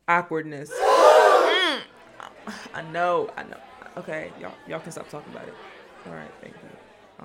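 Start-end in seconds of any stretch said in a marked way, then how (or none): 0.7-1.8 high, loud mock-shocked hum. Reacting to own awkwardness. 6.1-7.2 slow whispered aside; whispered thank you. Acted. Hushing imagined crowd.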